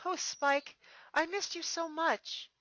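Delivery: high, slow, loving reunion; fond princess. Acted. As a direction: pour out how much you missed him. Twilight greets Spike.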